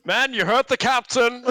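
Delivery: high, loud shout to the crew; shouted command. Acted. Officer rallies the men after the captain's order, shouted. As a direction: bellow it to back up the captain.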